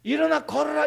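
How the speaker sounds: a bold public command. Shown as a demonstration. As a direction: call it out boldly. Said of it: "그렇게 크게 말했다가".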